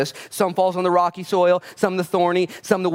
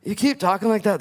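worried disciple; 6 semitones higher than usual. Retold or imitated. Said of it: "his disciples could have been like".

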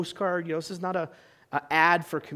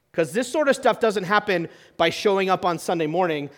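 normal talking voice

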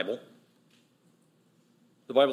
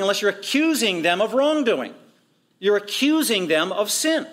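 higher-pitched, insistent pulpit delivery; emphatic preaching; 7 semitones higher, 4 dB louder than usual. Real, not acted. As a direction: drive the accusation home, stretching the key words. Preaches the accusation with force.

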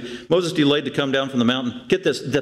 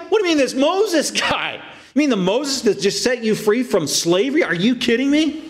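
high-pitched incredulous retort; outraged questioning, pitched way up; indignant disbelief; 8 semitones higher than usual. Acted. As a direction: fire back, appalled at the slight.

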